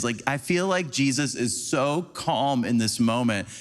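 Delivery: normal talking voice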